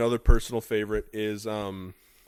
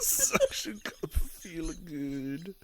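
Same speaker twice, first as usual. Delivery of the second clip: slow savouring repeat; 3 semitones higher, 9 dB quieter, slower than usual. Pretend. Repeats the line slowly.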